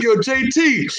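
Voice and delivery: high, urgent call to a friend. Acted. Runner calls partner for help, urgent. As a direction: holler for backup. Calling friend after running away.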